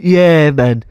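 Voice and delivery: hearty Cockney-mate reply; fake Cockney. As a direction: play the matey Londoner in Cockney. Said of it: "Cockney one down".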